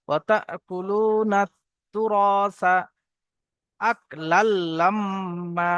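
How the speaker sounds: slow drawn-out melodic chant; Quran recitation. Acted. Teacher reciting the verse for students, slow melodic chant. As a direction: intone it slowly, stretching the vowels.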